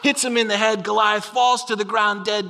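ordinary talk

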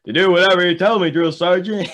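fast, high, loud shouted reply; eager recruit answer. Retold or imitated. Forrest shouts his answer to the drill sergeant, fast and loud. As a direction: snap it back like a keen private. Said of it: "And he goes".

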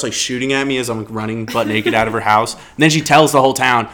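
ordinary talk, nothing marked

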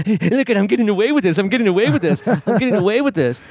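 high, gleeful sneak voice; smug sneaking thief. Retold or imitated. Author sneaks off with a book, high and smug. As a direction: chant it like a sneak who thinks he's unseen. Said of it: "walked out with, like".

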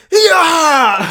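loud, high mock karate "hiyah"; hammed-up villain's kick shout; over-the-top kick yell. Host imitating Kenneth kicking in Tenet, over-the-top yell. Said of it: "it's just such an over the top".

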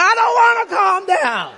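high, shouted refusal; hammed-up tantrum yell; joining in anger. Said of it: "join her in her anger".

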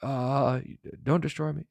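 lightly voiced nervous sinner; mock pleading. Sinner begging God not to destroy him, mildly pleading.

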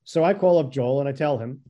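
usual speaking voice

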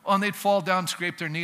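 ordinary delivery

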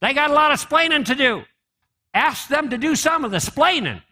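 imitating a Cuban accent with a lilting, high voice. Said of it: "In the immortal words of [name]".

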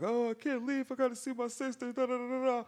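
high, fast mock panicky voice; a high squeaky plea, hurried; scared trapped coworker. Coworker begging to see his sister, high mock panic. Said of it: "the dude was saying".

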